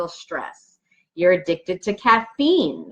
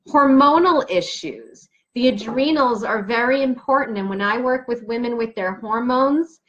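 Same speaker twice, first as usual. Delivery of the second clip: normal talking voice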